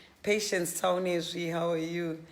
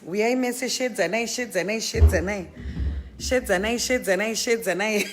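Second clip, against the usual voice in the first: loud, higher playful imitation; Sisimeli's voice; 3 semitones higher, 6 dB louder than usual. Greeting the live audience in someone else's voice, playful loud imitation. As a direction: put on someone else's voice, raised and punchy. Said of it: "with Sisimeli's actual voice".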